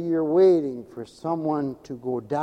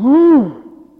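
mock woo; 9 semitones higher, 11 dB louder than usual. Mock reaction to good news.

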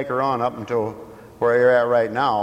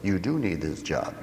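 soft, low steady reply with stress on do; mild calm correction; 5 semitones lower, 7 dB quieter than usual. Acted. The smarter self answering the outburst calmly. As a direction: settle the angry self quietly. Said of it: "your smarter self says".

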